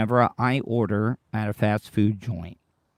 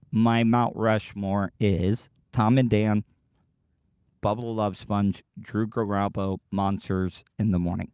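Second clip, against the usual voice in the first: ordinary delivery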